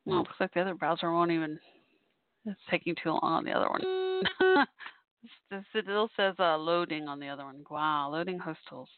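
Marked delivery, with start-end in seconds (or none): 3.9-4.9 high amused laugh. Real, not acted. Laughing at slow browser.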